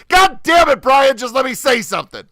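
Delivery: loud, high shout of frustration; mock angry shout. Podcast host losing patience with Brian, mock shouted. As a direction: yell over everyone, hammed-up. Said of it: "we would gradually start to get louder".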